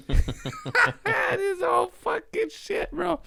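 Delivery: high, slow words squeezed out through laughter; can barely talk for laughing; laughing so hard the voice cracks high. Real, not acted. Cracking up retelling the story.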